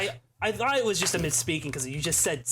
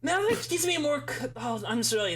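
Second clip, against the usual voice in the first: usual speaking voice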